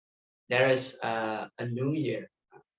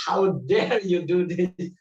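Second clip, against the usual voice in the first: high, loud, drawn-out indignant retort; mock outrage; 7 semitones higher, 6 dB louder, slower than usual. Retelling how upset he was with his friend, mock outrage. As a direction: act out being upset, with amusement breaking in. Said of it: "so upset with him".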